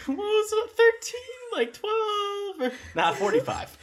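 squeaky laughing, very high. Real, not acted. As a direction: laugh through the words. GM laughing at mistake.

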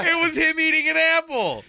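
high, loud shout through laughter; hysterical delight. Real, not acted. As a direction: crow it while cracking up. Cracks up at the apple reveal.

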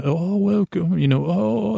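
high-pitched gushy restaurant greeter; gushing welcome. Acted. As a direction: act out overly nice staff welcoming a guest. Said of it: "the people are so, n".

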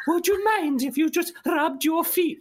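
high, slow mock Welsh lilt; mock Welsh accent. Mocking the jester's put-on Welsh, high and slow. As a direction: ham up a creepy Welsh jester. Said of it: "this weird Welsh accent".

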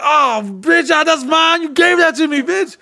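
very high, squealed objection; indignant mock protest. Boyfriend protests as his gifts are taken, squealing indignantly. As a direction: squeal in outraged disbelief, hammed up. Acts out quarrel over his belongings.

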